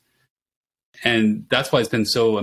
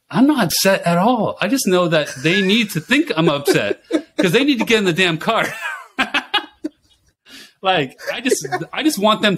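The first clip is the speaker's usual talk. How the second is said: higher-pitched, laughing self-defence; amused protest; 9 semitones higher than usual. Retold or imitated. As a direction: insist brightly, breaking into laughter. Retold protest to wife, amused.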